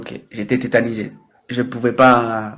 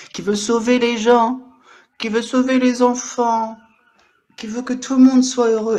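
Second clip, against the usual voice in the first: grandiose proclamation; 8 semitones higher than usual. Acted. Live streamer casting himself as saviour, grandiose. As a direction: proclaim it high and stretch it out.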